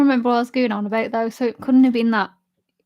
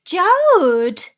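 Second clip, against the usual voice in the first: drawn-out call with a big high swoop, louder; sister telling off; 6 semitones higher, 7 dB louder than usual. Acted. Recalling her sister's nagging, loud drawn-out swooping call. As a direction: holler the name like a nagging sister. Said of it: "all I can hear is a".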